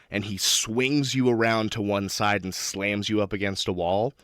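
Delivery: normal talking voice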